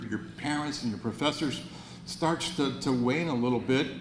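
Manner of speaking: ordinary talk, nothing marked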